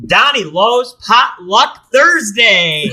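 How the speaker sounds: big, high, drawn-out title reveal; show announcer. Pretend. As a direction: announce it loud and slow like a show host. Unveils proposed name for the show.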